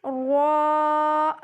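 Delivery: را full-mouthed as "raw", held long; thick heavy ra. Shown as a demonstration. Teacher modelling ra with zabar, thick drawn-out را. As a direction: round the lips slightly and sustain را on one note. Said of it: "آپ نے موٹی پڑھنی ہے".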